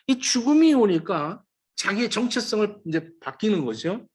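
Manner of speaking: normal talking voice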